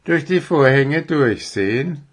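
touch of irritated disbelief; annoyed. A narrator's reading. Owl snaps back at the deer's request, annoyed. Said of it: "fragte Willi verärgert".

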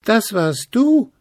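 Stachelchen contradicting. A narrator's reading. Stachelchen contradicts Gruseli, high and bristly. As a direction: bristle back at him. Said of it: "sagte Stachelchen".

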